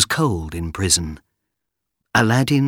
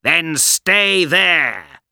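villain's threat; 8 semitones higher, much slower than usual. Acted. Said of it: "shouted Abanazar".

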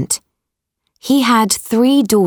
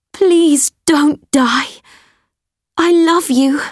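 tearful plea; 8 semitones higher than usual. A narrator's reading. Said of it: "she said".

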